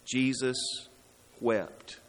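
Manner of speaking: low, soft, with a long pause between words; hushed, sorrowful weight on each word; solemn, reverent. Shown as a demonstration. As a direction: say it slowly and gravely, letting it sink in. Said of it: "may that never be the only way we remember it".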